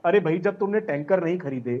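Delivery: ordinary talk, nothing marked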